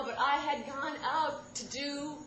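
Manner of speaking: building to a loud, high "my art!"; slow build, then belt out "my art"; triumphant shout. Real, not acted. Comic mother belting out her triumph.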